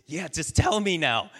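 can't wait to hear it; eager pig. Acted. Pig eagerly begging the chicken to tell him, excited.

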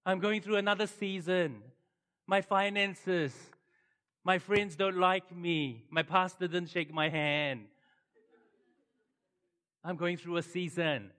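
milk the complaint for pity; mock self-pity. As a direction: parody of a moaning churchgoer. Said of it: "people go".

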